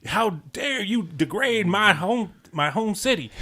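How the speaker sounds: high, slow indignant Southern drawl; hammed-up offended Southern pride; mock Southern accent. Red imitating his friend's outrage, mock Southern accent. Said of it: "It's like".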